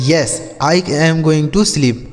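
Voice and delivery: slow, careful English question; slow model reading. Shown as a demonstration. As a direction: read it out slowly for learners. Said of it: "slow model answer".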